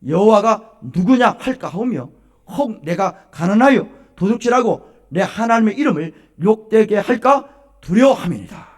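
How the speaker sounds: solemn scripture proclaimed at high volume; scripture reading. Acted. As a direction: proclaim the verse phrase by phrase, forcefully. Reads scripture aloud to congregation.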